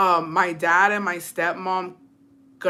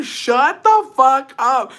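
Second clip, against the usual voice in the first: loud, high, drawn-out outburst; mock-horrified yell to shut him up; retold telling-off; 6 semitones higher, 7 dB louder, much slower than usual. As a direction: stretch every word, loudly scandalized. Retells telling Jacobo off.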